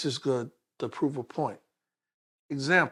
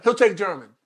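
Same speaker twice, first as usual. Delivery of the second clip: firm cut-in; 5 semitones higher, 9 dB louder than usual. Retold or imitated. Mother answers for him at once.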